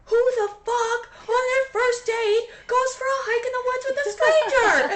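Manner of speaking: very high, slow incredulous shout; outraged older manager. Retold or imitated. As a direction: imitate the boss losing his mind. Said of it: "literally was like".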